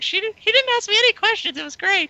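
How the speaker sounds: mock high breathy girl voice, way up high; girl's voice. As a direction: squeal it like a dreamy girl gushing. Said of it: "she's like".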